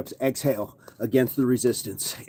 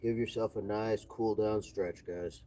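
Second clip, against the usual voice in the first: soft, easy stretching guide; calm cool-down; 7 dB quieter than usual. Acted. Coach guiding viewers through a stretch, soothing. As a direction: ease them down gently.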